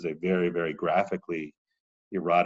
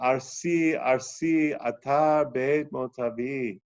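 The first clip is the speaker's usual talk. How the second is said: louder, slow lamenting chant; dramatic recitation; 5 semitones higher, 4 dB louder than usual. Acted. Teacher reciting the Shekhinah's wail in Aramaic, dramatic chant. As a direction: cry out the goddess's mourning.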